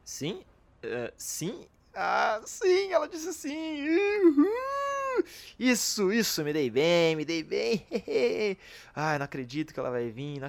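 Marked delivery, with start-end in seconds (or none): 1.9-5.2 high joyful whooping cheer; excited cheer. Acted. Celebrating her yes. 5.6-8.6 excited. Acted.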